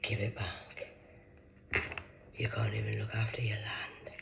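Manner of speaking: soft whispered goddess voice; Pachamama's whisper. Acted. Voicing Pachamama scolding people, a soft whisper. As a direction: whisper as the earth mother. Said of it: "Pachamama says".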